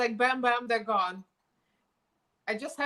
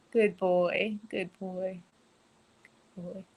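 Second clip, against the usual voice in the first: fading praise; 3 semitones lower than usual. Real, not acted.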